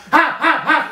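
high, loud triple bark; dog barking. Acted. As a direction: bark sharply like a watchdog. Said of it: "Já jsem štěkal". (ostrý psí štěkot)

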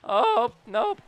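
loud startled oh; surprised shout. Real, not acted. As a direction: yelp at the play. Big play surprise.

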